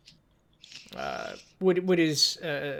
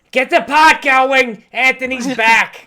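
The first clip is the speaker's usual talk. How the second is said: loud, slow call to the back room; bartender shouting; 9 semitones higher, 15 dB louder, slower than usual. Acted. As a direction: bellow the order to the kitchen, drawn out. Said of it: "she shouts down to the back".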